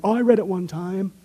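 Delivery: lightly voiced sore-throated skeptic; mock sore-throat voice. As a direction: croak it out like a smug reader. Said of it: "they always have that sore throat when they talk like that".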